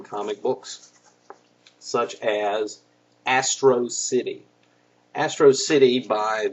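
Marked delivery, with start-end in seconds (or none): none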